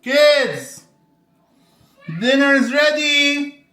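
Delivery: loud call across the house. Real, not acted. Calling the kids to dinner.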